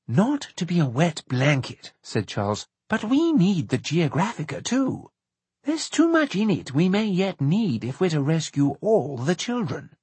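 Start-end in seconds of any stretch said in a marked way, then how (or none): 0.1-1.9 a hint of a fretful companion's voice; mild, reluctant protest; lightly voiced fussy objector. A narrator's reading. Narrator voices Charles objecting. 2.9-10.0 higher-pitched character voice, pressing the point; high-voiced companion making his case; insistent objector. A narrator's reading. Narrator voices Charles objecting.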